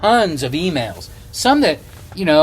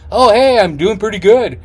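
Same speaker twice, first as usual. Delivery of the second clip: higher, brighter voice of a polite stranger; cordial reply; 7 semitones higher, 6 dB louder than usual. Retold or imitated. Stranger cheerfully answering a hello, higher-pitched and polite. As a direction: play the friendly passerby answering back.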